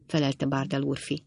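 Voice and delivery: ordinary delivery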